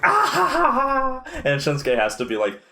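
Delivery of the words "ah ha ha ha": very high mock cackle; hammed-up high-pitched "ah ha ha"; Sami Zayn. Imitating an overjoyed wrestler after the move, high mock cackle. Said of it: "And then he's like".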